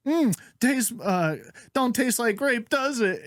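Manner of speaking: high, drawn-out mock nurse; put-on high voice, slow and teasing; nurse's stock line. Nurse to gagging patients, high mock patter. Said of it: "she had the same line every time".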